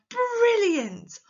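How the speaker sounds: high, loud, drawn-out exclamation; excited. Shown as a demonstration. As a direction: burst out with delight. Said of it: "they're surprised or they're excited".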